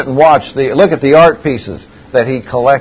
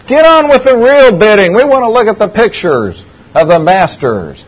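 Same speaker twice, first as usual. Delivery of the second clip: loud, high impatient yell; impatient shout; 8 semitones higher, 5 dB louder than usual. Retold or imitated. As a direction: shout over the auctioneer. Impatient bidder at auction.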